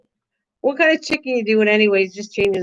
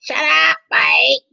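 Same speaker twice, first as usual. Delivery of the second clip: imitating pop rock; 6 semitones higher, much slower than usual. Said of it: "the way pop rock says it to me".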